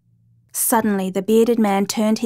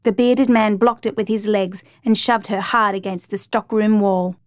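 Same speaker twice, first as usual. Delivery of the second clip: plain reading voice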